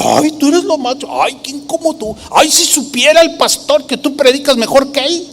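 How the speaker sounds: high, put-on gushing; flattering. A flatterer praising a church member over the pastor, flattering. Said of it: "¿Saben lo que es una persona lisonjera?".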